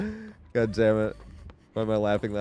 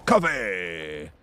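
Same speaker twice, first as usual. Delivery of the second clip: Coughing's smoker voice; 6 semitones lower, 3 dB quieter than usual. Retold or imitated. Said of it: "In Coughing's voice, that smoker voice".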